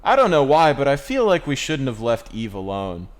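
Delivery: put-on kid voice. Quoting the kid.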